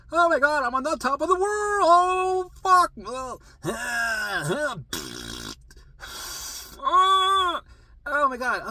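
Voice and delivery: high, loud hammed-up rapture; top of the world. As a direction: shriek with mock joy. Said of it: "So it's like".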